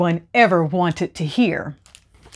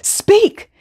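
desperate command; 14 semitones higher than usual. A narrator's reading. Man pleads with skull.

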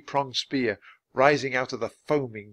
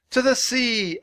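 high, carrying cry; rallying shout, pitched way up; calling over the wind; 12 semitones higher, 4 dB louder than usual. A narrator's reading. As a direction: call out a heading over the wind. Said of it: "Annie called over the wind".

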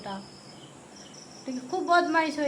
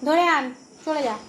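higher and louder, egging the pet on; loud urging; 4 semitones higher, 7 dB louder than usual. Real, not acted. Owner urges her pet to chase the lizard, loud and high call. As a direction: call out sharply to send the pet after it.

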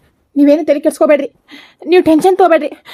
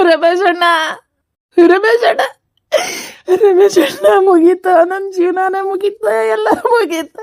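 tearful, despairing wail; grief-stricken sobbing plea; crying; 2 semitones higher than usual. Acted. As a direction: cry out to an elder brother for help. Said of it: "ಯಾಕ್ ಅಳಕತ್ತಿ ನೀನು".

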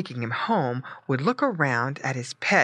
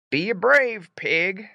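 slow, louder papa voice, only slightly raised; papa pig; 4 semitones higher, 5 dB louder, slower than usual. A narrator's reading. Papa pig to departing Squinty, firm farewell. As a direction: urge it slowly and firmly as papa. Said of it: "said his papa".

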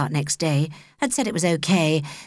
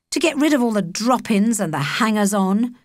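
plain reading voice

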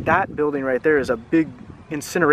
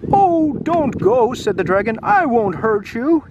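much higher, slow, pleading dragon voice; the lonely dragon begs the children to stay, sad and slow; 7 semitones higher, 3 dB louder than usual. Acted. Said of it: "He sounded very sad".

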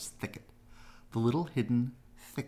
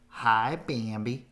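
Thumper greeting; 2 semitones higher, 7 dB louder, slower than usual. A narrator's reading. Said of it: "said Thumper".

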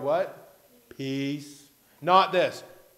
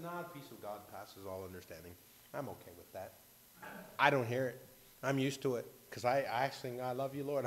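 put-on much softer, much lower, a calm old lady's answer; soft calm reply; 5 semitones lower, 9 dB quieter than usual. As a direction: speak it softly and untroubled. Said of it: "She said".

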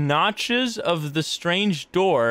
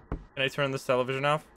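ordinary talk

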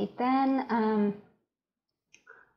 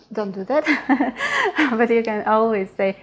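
laughing; 5 dB louder than usual. Real, not acted. Joking warning, laughing.

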